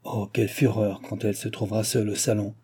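usual speaking voice

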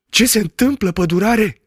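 high, startled exclamation; exclaiming. Acted. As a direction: blurt it out in surprise. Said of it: "exclamă doctorul Patac". (exclamație uimită, mult mai sus)

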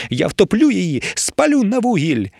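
high-pitched raging threats; seething. A narrator's reading. Emiliia just bitten on the calf, seething. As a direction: boil over into a high, furious tirade. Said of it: "кипіла Емілія".

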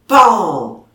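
loud imitated bang of horn on wood. A narrator's reading. Narrator imitating the horn hitting the wood, loud bang. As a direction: punch out the impact noise.

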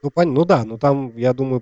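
ordinary talk

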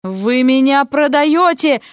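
indignant exclamation. Acted. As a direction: protest as if betrayed. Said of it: "воскликнул Эрик".

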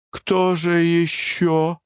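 a frightened, faltering mumble; babbling meekly. A narrator's reading. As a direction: let the question slip out, frightened. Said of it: "пролепетал Клевринг".

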